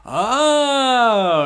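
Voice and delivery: loud, high drawn-out oh; light up as it dawns; realizing oh. Acted. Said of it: "then he goes".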